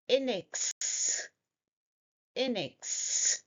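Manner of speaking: "In X" said as a separate chunk; first two syllables joined. Shown as a demonstration. Teacher breaking down a hard word, syllable demo. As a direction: sound out "In X", lingering on the final s. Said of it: "I will break down the pronunciation".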